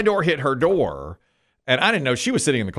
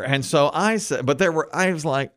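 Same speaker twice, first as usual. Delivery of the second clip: ordinary talk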